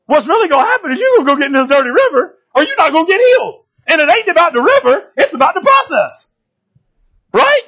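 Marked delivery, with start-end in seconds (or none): 0.1-6.3 servant voice. Retold or imitated. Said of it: "He's like".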